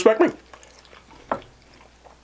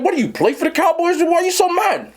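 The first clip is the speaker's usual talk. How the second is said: high, incredulous teasing question; mock-outraged boyfriend ribbing his pouting girlfriend; mocking disbelief; 11 semitones higher than usual. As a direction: needle her for sulking over the team. Mocking girlfriend over Cowboys loss.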